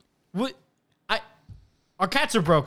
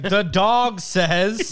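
slow, louder farm-toy voice; toy narrator; 5 semitones higher, 5 dB louder, much slower than usual. Pretend. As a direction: drag it out like a talking toy. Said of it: "Be like".

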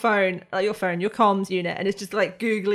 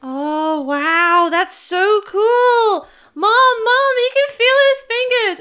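put-on high, loud kid calling his mom; a child yelling the news to mom; delighted kid shouting; 13 semitones higher, 8 dB louder, much slower than usual.

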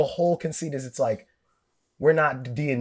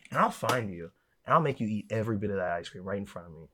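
soft, low, creeping threat; low menacing threat; 4 semitones lower, 6 dB quieter, faster than usual. Pretend. Threatening friends over stolen ice cream, low and soft. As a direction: lean in and promise it quietly, low.

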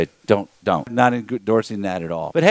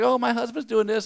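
mock excited gossip, pitched higher; chatty woman; 11 semitones higher than usual. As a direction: lightly imitate her eager chatter. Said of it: "She's like".

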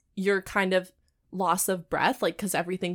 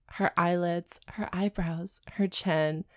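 breathless whisper; 3 semitones lower, slower than usual. Pretend. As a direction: whisper each word, out of breath. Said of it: "he whispered breathlessly".

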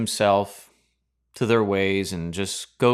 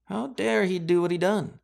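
higher put-on indignant Pharisee voice; mock Pharisee; 7 semitones higher than usual. Preacher voicing Pharisees to congregation, put-on indignation. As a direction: squeak it like a scandalized Pharisee.

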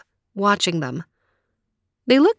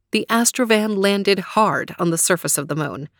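ordinary delivery

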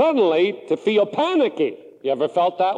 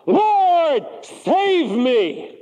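crying out to the Lord; 8 semitones higher, much slower than usual. Acted. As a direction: cry it out to God for rescue. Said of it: "Here's what Peter cried".

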